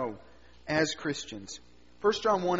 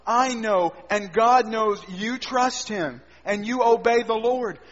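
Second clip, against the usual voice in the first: usual speaking voice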